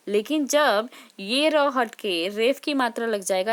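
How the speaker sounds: ordinary talk, nothing marked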